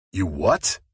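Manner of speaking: a touch of shocked disbelief. Acted. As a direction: friend hearing she is in jail, in disbelief. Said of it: "he said in disbelief".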